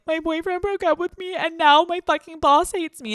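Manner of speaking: high, whiny mock despair; high mock-distraught rant to the boss; upset employee. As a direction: wail it like your day is ruined. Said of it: "Meanwhile, you're like".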